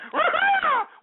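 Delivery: high, loud imitation squeal; exaggerated mimicked shriek; mocking squeal. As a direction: squeal back at the caller in mockery. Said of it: "What the hell kind of a squeal was that?".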